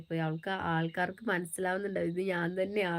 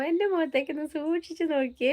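quoting the comedian Pappu in a higher put-on voice; higher, put-on comic film voice for the famous line; playful nod to the comedy scene, higher comic voice; Pappu impression; 8 semitones higher than usual. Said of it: "അതൊരു ആസ്വാദ്യ comedy അല്ലേ പപ്പുവിന്റെ".

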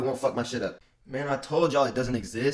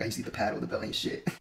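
father shrugging off the belt, quiet scoff; dad scoffing; 2 semitones lower, 9 dB quieter than usual. Retold or imitated. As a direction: mutter it like a dad brushing it off. Dad voiced in story.

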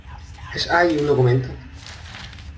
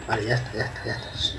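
hushed calming; 8 dB quieter than usual. Real, not acted. Calming a hostile guard.

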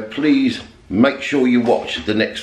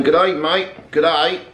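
put-on higher-pitched playful Australian accent; 7 semitones higher than usual. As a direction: put on a jokey Australian greeting. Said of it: "I need some Australian ones next".

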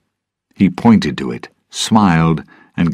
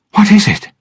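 loud, high-pitched urgent question; alarmed; 6 dB louder, faster than usual. A narrator's reading. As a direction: ask in alarm, voice shooting up. Alarmed question amid shouted warning.

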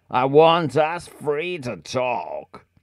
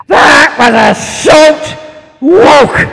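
mock shout; 10 semitones higher, 13 dB louder than usual. Real, not acted. Mock outrage at a short scene.

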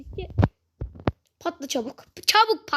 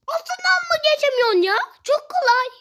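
high, fast exasperated complaint; 10 semitones higher than usual. Real, not acted. Gamer scolding himself for failing an easy jump. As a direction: rattle it off, fed up with yourself.

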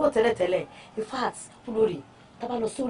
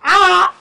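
loud, sharp "ah!"; loud gasp of disbelief; shocked outcry; 7 semitones higher, 12 dB louder than usual. Acted. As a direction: cry out in protest. Shocked at order to cook.

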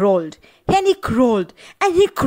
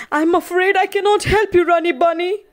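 higher, fast and hurried; frightened bear; 7 semitones higher, faster than usual. Acted. Bear apologising to the rabbit, higher and hurried. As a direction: blurt it out, scared to help.

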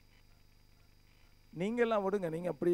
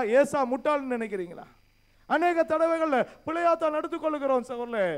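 loud, raised preaching voice, pressing the questions; forceful preaching; 5 semitones higher, 7 dB louder than usual. Acted. Pastor challenging the brothers in church, loud forceful preaching. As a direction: hammer each question at the congregation.

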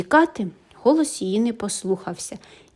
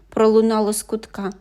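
ordinary delivery, nothing marked

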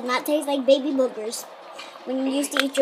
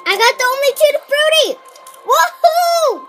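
loud high whoop of joy; excited cheer; 9 semitones higher, 6 dB louder than usual. Real, not acted. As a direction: cheer like you won. Cheering at the flavor.

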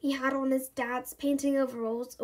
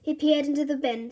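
plain reading voice

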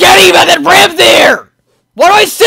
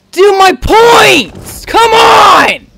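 high, drawn-out yell; frustrated shout; 5 semitones higher, much slower than usual. Real, not acted. Player yelling at the game, frustrated shout. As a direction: stretch it into an exasperated shout.

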